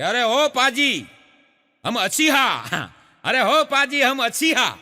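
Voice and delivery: slow, loud Punjabi call from the well; Punjabi accent. Retold or imitated. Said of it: "Punjabi ભાષામાં કીધું". (પંજાબી લહેકામાં ધીમી, મોટેથી બૂમ)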